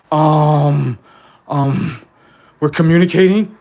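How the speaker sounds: the teacher's imagined student hesitating aloud; hesitant listener. Pretend. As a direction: play the student fumbling for the answer.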